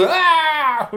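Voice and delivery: high, loud squeal of a laugh. Acted. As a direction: shriek with delight as the beard. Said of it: "You're just like".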